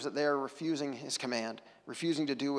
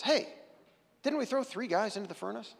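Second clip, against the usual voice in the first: higher, alarmed king's question; startled king; 7 semitones higher than usual. Acted. Preacher voicing the king startled at the furnace, higher. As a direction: jump up in surprise at the fire. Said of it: "Nebuchadnezzar jumps up and says".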